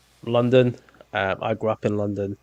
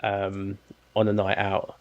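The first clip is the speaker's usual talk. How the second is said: ordinary delivery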